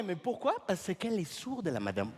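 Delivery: ordinary delivery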